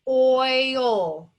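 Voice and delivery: oil said loud and held long; emphasized word. Shown as a demonstration. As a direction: lean hard on oil. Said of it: "O I says oi".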